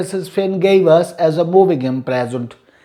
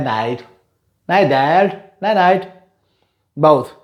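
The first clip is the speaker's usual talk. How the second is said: ordinary talk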